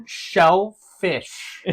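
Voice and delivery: "shellfish" very slowly, as two separate words; overcareful, drawn-out "shellfish"; careful pronunciation. Shown as a demonstration. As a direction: sound out "shellfish" with a clear sh and a long gap. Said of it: "some words that I can't say".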